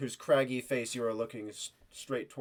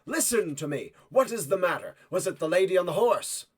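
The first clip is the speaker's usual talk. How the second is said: higher, firmer questioning; urgent soldier; 5 semitones higher, 5 dB louder than usual. Acted. Soldier pressing the frightened woman for answers. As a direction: press her gently but urgently.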